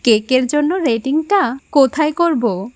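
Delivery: light mock-serious question; playful teasing. Woman joking with courier about rating a gift, playfully. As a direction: tease him gently with a straight question. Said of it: "একটা মজা করে বলল".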